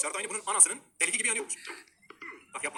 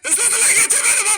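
same words screamed much higher and louder, laughing; panicked yell; 14 dB louder than usual. Real, not acted. Screaming at his climber to hurry as he falls. Urging the climber on, then falling.

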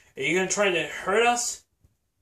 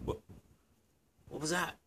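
whisper; 6 dB quieter, much slower than usual. Acted. Sneaking in the dark.

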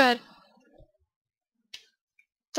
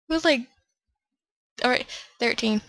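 usual speaking voice

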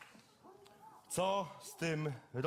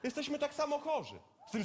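ordinary talk

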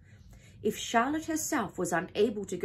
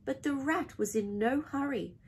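ordinary delivery, nothing marked

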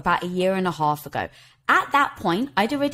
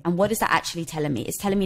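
normal talking voice

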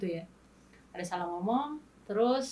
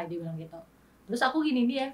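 ordinary talk, nothing marked

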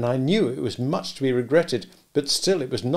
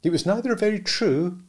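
put-on much higher young woman, gently reproving; Biddy rebuking Pip gently, higher voice; Biddy's mild rebuke; 10 semitones higher, 4 dB louder than usual. A narrator's reading. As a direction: voice Biddy higher, correcting him kindly.

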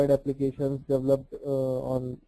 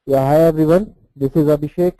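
louder, clearer into the mic; speaking up louder; 8 dB louder than usual. Real, not acted. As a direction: project so everyone hears. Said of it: "speak up a little more louder".

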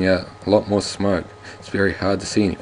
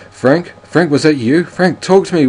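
much higher, louder panicked calling; villain cries out to his wounded partner; cries out in alarm; 9 semitones higher, 8 dB louder than usual. Acted. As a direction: call out for a shot friend. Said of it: "William Mayfield cries out".

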